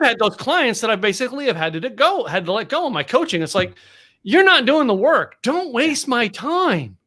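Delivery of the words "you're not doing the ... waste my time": higher-pitched mock scolding; lightly exasperated coach laying down the law; acted stern rebuke. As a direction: draw out the telling-off. Acted rebuke to his coaching clients.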